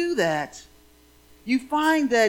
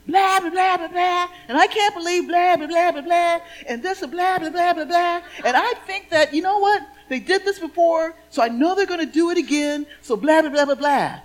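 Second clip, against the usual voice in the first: high, loud exasperated rant; student venting to her teacher, loud and high; frantic ranting; 8 semitones higher, 6 dB louder than usual. Retold or imitated. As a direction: spill it all out in a breathless complaining gush. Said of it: "And me going".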